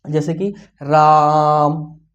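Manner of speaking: a long prolonged call of राम; drawn-out call. Shown as a demonstration. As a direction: stretch राम out long as if calling someone far away. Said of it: "लंबे समय तक पुकारना है".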